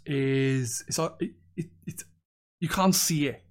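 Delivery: ordinary talk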